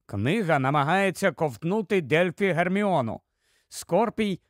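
ordinary delivery

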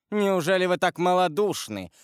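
husband pressing his accusation with growing fury; rising heat. A narrator's reading. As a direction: press the charge harder. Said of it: "продолжал Вильфор с возрастающим жаром".

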